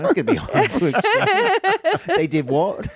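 laughing through the words; can't stop laughing mid-sentence. Real, not acted. Laughing at own joke.

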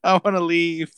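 frightened whine, wanting out; imitating his own helpless panic; panicked. Panicking at the fight.

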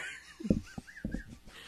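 very high squealing laugh; helpless high-pitched cackle, near crying; hysterical laughter. Real, not acted. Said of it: "like, hysterics right now".

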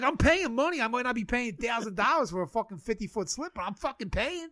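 high, loud defiant protest; mock indignant shout. Telling the marina he's paying, a loud high defiant protest.

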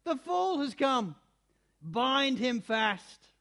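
loud scornful command; slow, loud order to bind; shouting. A narrator's reading. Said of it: "she cried".

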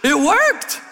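very high, loud gloating; cocky teen triumph; smug triumph. Retold or imitated. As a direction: crow about getting away with it. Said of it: "I was like".

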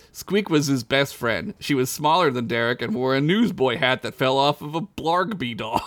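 ordinary talk, nothing marked

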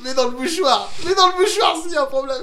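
high-pitched helpless laughter; laughing hard. Real, not acted. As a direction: laugh through the words, almost crying. Friend gagging on tasted food.

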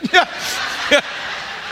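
soft, breathy chuckle; laugh. Real, not acted. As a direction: laugh quietly at your own story. Laughs at own story.

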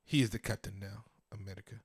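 low, soft African accent impression. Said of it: "I have good African accent".

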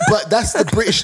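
ordinary delivery

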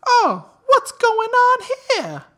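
high, slow, loud mock surprise; surprised mom. Storyteller voicing the mom walking into the house, high and loud. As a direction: play the mom startled walking in.

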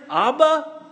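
lifted Abba; tender cry. Acted. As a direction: call out to a father. Intimate cry to God.

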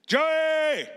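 high, drawn-out call across the house; parent yelling. Acted. Parent calling kid from another room, high mock yell. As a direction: holler the name from another room, mildly. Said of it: "they yell out your name".

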